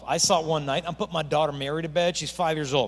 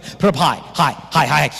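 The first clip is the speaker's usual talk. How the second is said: louder, rallying the crowd; calling out; 9 dB louder, slower than usual. Real, not acted. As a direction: pump the audience up. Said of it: "urging crowd hands higher".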